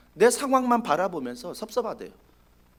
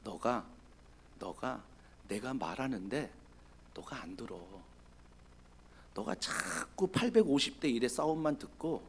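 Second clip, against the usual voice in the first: quieter, gentle scolding; soft rebuke; 10 semitones lower, 16 dB quieter than usual. Acted. God gently rebuking Elijah for not listening. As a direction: correct him tenderly.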